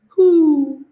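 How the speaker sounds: high pigeon coo; playful pigeon impression. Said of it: "Carboxylic acids are pigeons".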